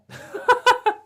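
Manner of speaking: high loud burst of laughter; loud high cackle. Real, not acted. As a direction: crack up. Laughing at the post.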